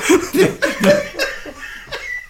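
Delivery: högt, pipigt skratt som stiger; gapskratt. Real, not acted. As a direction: kiknar av skratt. Roas åt skämtet. (ljust, befriat skratt)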